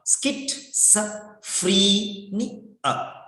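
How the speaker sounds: schizophrenia broken into skit, sa, free, ni, a; syllable-by-syllable pronunciation. Shown as a demonstration. Teacher drills students on a hard word, syllable by syllable skit-sa-free-ni-a. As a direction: sound out skit-sa-free-ni-a with pauses between syllables. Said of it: "See the pronunciation".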